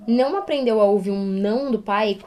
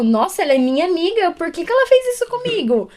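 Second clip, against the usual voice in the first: put-on high, hurt child's complaint; hurt little girl; 9 semitones higher, 5 dB louder than usual. Teacher imitates a toddler upset her friend ate her nose, high and hurt. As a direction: plead like a betrayed toddler.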